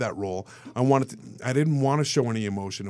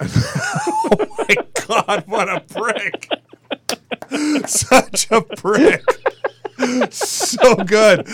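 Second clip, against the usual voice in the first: laughing; 10 semitones higher, 7 dB louder, much slower than usual. Real, not acted. Laughing about Hasselhoff.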